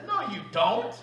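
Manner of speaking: laughing as he says it. Real, not acted. To the room about the ballet crowd, laughing. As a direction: chuckle the rebuttal through a laugh.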